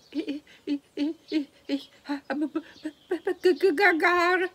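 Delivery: high, stammering with fear, rising loud; frightened boy stuttering; terrified stutter. A narrator's reading.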